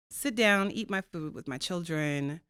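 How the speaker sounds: ordinary delivery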